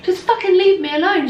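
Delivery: a retold flash of temper; mock angry outburst. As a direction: fling it off in irritation. Quoting her pissed-off reply.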